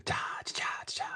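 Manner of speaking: whispered little "da, da, da"; whispered joke tag. Pretend. Streamer capping a that's-what-she-said joke, whispered sting. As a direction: whisper a drum sting after the punchline.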